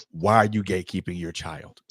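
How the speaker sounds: normal talking voice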